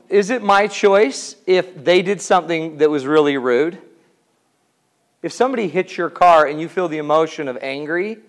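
usual speaking voice

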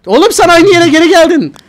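loud, high frustrated yell; angry yell. Real, not acted. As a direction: blow up at the game. Frustrated at game glitch.

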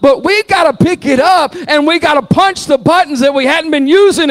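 loud, high, fervent preaching; preaching at full force. Real, not acted. Pastor rallying the church, shouting fervently. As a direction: rouse the church at full volume.

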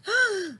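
sharp high gasp; loud gasp. Shown as a demonstration. Teacher showing the class how to gasp, sharp gasp. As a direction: gasp in shock. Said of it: "Noelle, can you gasp? Like this?".

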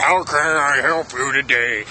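slow, put-on clerk voice; odd store clerk voice. Repair clerk greeting a customer, in a slow odd voice. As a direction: drag it out like a weird shop assistant. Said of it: "why are you talking to me like that?".

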